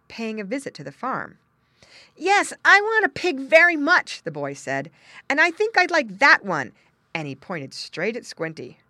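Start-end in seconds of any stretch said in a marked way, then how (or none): 2.2-4.2 put-on high, loud boyish voice; eager boy. Boy answering farmer, eager. 5.3-6.7 put-on high, loud child voice; eager boy. Boy picking out a pig, eager.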